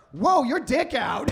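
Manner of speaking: high, loud, slow startled shout; startled outburst at the man; startled yell. Retold or imitated. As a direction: blurt it out in shock. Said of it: "I'm like".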